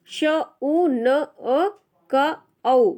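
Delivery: slow, letter by letter; teacher sounding out each letter for pupils. Shown as a demonstration. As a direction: spell it out sound by sound, very slowly. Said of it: "हम इनको मिला मिलाकर अगर करेंगे".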